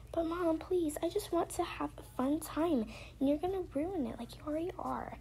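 high, whiny daughter voice; pleading child. Pretend. Daughter begs mom for some fun time, pleading. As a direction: play a kid pleading with mom.

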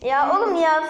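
loud, high cry of protest; exasperated shout. Real, not acted. Frustrated player yelling at friend, loud protest. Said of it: "Sen kendin bağırıyorsun".

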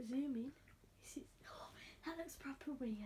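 whispering. Real, not acted. Whispering to avoid disturbing hamster.

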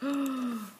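drawn-in breath of amazement; gasp. Acted. Missus Silver hearing of the secret, amazed gasp. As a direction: gasp at a revelation.

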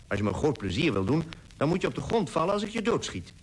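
fast and eager, pitch climbing on the second half; eager quick request. Acted. Titus eagerly asks the narrator to play dead, fast. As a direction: rattle it off fast, like an excited boy at play. Titus playing with toy pistol.

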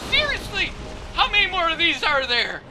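high, fast mock disbelief; exasperated game enemy. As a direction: squeal it as a fed-up enemy. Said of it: "He's like".

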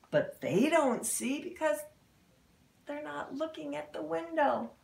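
usual speaking voice

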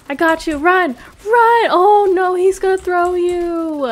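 very high, loud panicked yells; panicked shouting. Real, not acted. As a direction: scream warnings in panic. Giant grabbing teammate.

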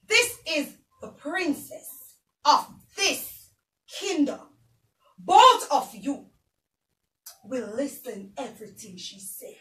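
slow, soft formal pronouncement; queen presenting the princess to servants, solemn and slow; solemn decree. Acted.

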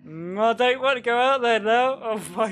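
high, loud dread-filled whine; real frightened protest; scared reluctance. Real, not acted. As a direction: wail it, too spooked to move. Scared to go out in game.

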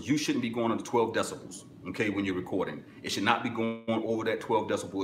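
usual speaking voice

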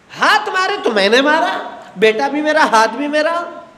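normal talking voice

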